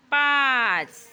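loud teacher's model count. Shown as a demonstration. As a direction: belt out the number for pupils. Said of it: "जोरात बोलायचं".

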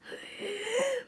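sharp high intake of breath; big high gasp. Acted. Hero gasping for air before crashing out. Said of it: "Takes a big gasp of air".

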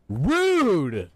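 loud, high, drawn-out cry; indignant call. Real, not acted. Gamer objecting to the game character, indignant.

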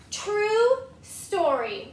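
very slow, high drawn-out claim; pigeon insisting. Acted. Pigeon swearing to the kids, slow and drawn out. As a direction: sell the fib with a long stretch.

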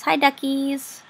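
high, slow cooing at the ducks. Real, not acted. Player cooing hello to the ducks in her game, sweet and slow. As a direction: coo hello like to little pets.

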